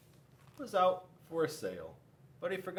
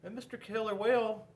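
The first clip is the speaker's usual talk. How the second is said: ordinary delivery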